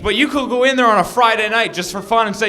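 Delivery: ordinary talk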